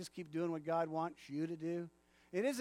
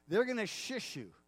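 usual speaking voice

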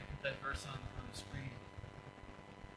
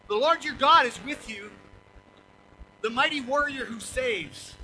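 louder, higher proclamation; proclaiming; 5 semitones higher, 7 dB louder than usual. Real, not acted. Preacher proclaiming the verse to the congregation, loud. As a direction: declare it with force.

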